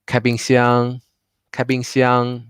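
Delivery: 开冰箱 with 箱 long and high; Chinese tones demo. Shown as a demonstration. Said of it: "the 箱 is much longer and higher pitch".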